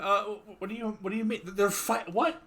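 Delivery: high, stammering confusion; flustered distracted man. GM voices flustered, fixated Sven.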